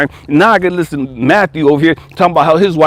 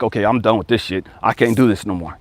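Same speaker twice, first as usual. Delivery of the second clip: weary resignation; 8 semitones lower, 6 dB quieter than usual. Acted. Addict finally giving up, quoted.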